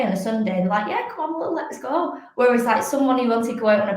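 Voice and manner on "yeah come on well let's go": higher-pitched quick yes to an invite; airy, up-for-it mock reply; eager agreement. Friends agreeing to a Sunday run, eager.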